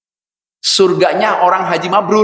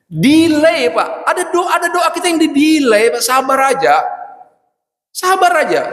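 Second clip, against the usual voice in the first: preaching emphasis; 13 semitones higher than usual. Real, not acted. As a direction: drive the point home. Preacher rises to emphatic point.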